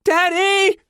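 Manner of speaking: put-on very high, loud child's cry. A narrator's reading. Small girl losing her grip on deck, crying out. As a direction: shriek for your father. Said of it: "Lana cries out".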